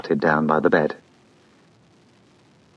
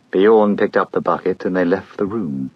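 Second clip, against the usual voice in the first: usual speaking voice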